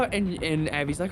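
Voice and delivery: ordinary delivery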